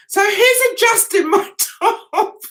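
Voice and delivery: high voice giving way to laughter; slow down as the giggles take over; laughing while talking. Real, not acted. Cracks up telling the story.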